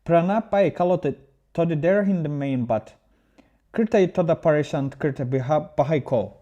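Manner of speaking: a playful, put-on Indian accent. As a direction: read it aloud in a mock Indian accent. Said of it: "read it with more Indian accent".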